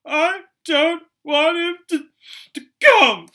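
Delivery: high, loud, slow whiny wail; yelling fake sobs. A narrator's reading. Spoiled boy fake-crying to his mother, high, loud wail. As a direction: bawl it out like a spoiled child faking tears. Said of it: "Dudley yelled between huge pretend sobs".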